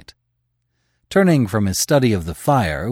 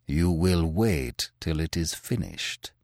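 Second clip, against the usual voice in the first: staccato Andalusian patois; 4 semitones lower, 6 dB quieter than usual. Acted. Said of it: "said Manfred, in the staccato patois".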